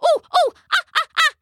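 high, loud monkey hoots; gleeful imitation of monkey whoops; joyful monkey chirps. A narrator's reading. Monkey at the runaway throttle, high joyful hooting. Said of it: "clapping and chirping with joy".

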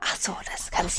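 playing along in a whisper; whispered conspiratorially. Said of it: "da muss man flüstern". (flüsternd mitgespielt)

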